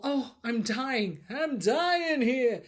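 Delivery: exaggerated mock dying groan; mock dying abuser. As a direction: ham up a fake deathbed whine. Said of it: "do the whole".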